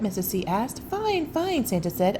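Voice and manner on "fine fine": cheerful. Acted. Santa voiced in story.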